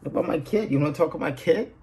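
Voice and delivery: outraged retort. Retold or imitated. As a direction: fire back like a protective parent. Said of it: "I'm like".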